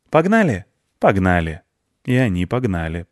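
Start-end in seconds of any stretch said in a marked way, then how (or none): 0.1-0.6 put-on high-pitched child's invitation; eager question. One child invites the other to race off, high and eager. 1.0-1.6 firm agreement. Acted. Other child accepts the invitation to race, firm and settled.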